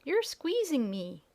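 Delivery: high, slow squeaky little pig voice; squeaky piglet. Acted. Tiny piglet squeals at Dorothy for squeezing it, high and slow. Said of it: "said the piglet with a squeal".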